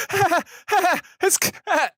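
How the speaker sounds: mock panicked panting, very high; hyperventilating. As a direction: re-enact gasping too hard to get words out. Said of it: "I'm starting to hyperventilate".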